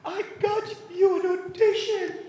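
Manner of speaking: slow, very high squeal of big news; mock breathless excitement. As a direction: squeeze each word out, hammed-up and overwhelmed. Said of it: "She couldn't even speak".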